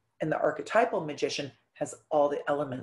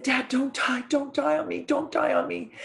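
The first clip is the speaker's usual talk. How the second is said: high, desperate begging, repeated; pleading with dad; 8 semitones higher than usual. Retold or imitated. Daughter begging her dying father not to die, high and pleading. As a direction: beg him not to leave you, voice pitched up.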